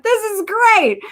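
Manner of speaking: high-pitched excited mock yell; retold yell. Fan cheering a home run, retold high-pitched yell. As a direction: cheer it out high, without full volume. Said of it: "I'm screaming and yelling".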